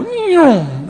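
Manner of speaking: high, loud mock car whoosh. Bishop imitating a passing car whoosh. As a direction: imitate a car zooming past. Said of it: "and a car would go".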